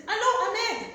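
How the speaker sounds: high, loud phone greeting; acted phone greeting. Shown as a demonstration. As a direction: call into the phone. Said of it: "You can say".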